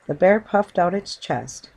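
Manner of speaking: ordinary delivery, nothing marked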